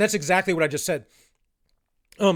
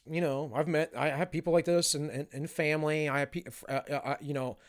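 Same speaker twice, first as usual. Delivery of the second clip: ordinary talk, nothing marked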